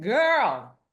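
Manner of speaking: put-on loud, high drawn-out "girl"; exasperated advice. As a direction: call her out with a big, stretched swoop. Advising friend who shares idea.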